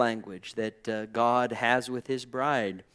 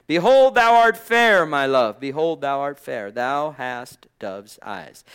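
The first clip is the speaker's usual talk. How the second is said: scripture declamation; 8 dB louder than usual. Acted. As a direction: burst out on the first phrase, then slow down to the end. Reads verse fifteen aloud, declaiming.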